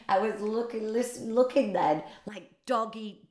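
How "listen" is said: ordinary delivery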